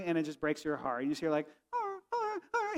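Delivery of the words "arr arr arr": high, soft whimper like a dreaming dog. Retold or imitated. Preacher imitating his bulldog whimpering in her sleep. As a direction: whine softly like a puppy in a nightmare. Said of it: "hear a dog whimpering".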